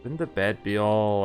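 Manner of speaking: ordinary talk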